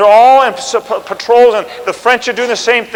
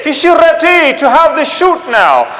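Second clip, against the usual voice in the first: high, loud, slow broken English with a French lilt; a French officer politely offering to start the shooting; mock French accent; 7 semitones higher, 4 dB louder, slower than usual. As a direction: ham up a French officer's courtesy. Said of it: "in broken English".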